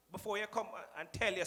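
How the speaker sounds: ordinary talk, nothing marked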